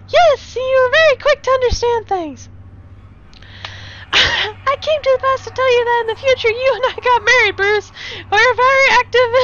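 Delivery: high put-on lady voice; high female voice, barely holding in laughs; future Sophie voice. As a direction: pitch way up, earnest and giggly.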